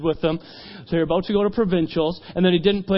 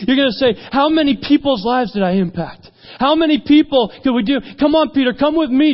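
impassioned, building higher and louder; rally a follower to a great mission; preacher's fervent, rising appeal; rousing call; 4 semitones higher, 4 dB louder than usual. Retold or imitated. Jesus casts vision to Peter.

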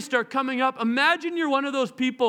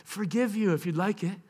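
tender invitation; 2 semitones lower than usual. Acted. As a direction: invite them in kindly. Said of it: "He goes".

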